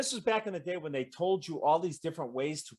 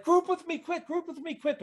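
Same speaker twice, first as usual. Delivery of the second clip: high, urgent plea to group up. Retold or imitated. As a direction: call out fast before it's too late. Urgent call while cyclops attacks.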